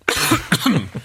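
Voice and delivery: real choking cough; coughing fit. Real, not acted. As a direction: cough after swallowing wrong. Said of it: "Ich hab mich verschluckt".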